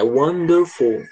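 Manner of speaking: slow syllable by syllable: won-der-ful; counting syllables. Shown as a demonstration. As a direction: say "wonderful" slowly, beat by beat. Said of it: "Se a gente contar".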